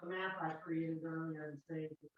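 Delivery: distant, off-mic voice; speaking from across the room; from another room. Real, not acted. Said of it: "You're a bit far from your microphone".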